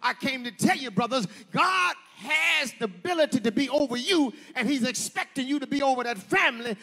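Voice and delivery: rising, shouted sermon cadence; preaching at full force. Acted. Preacher to brothers and sisters, shouting and building.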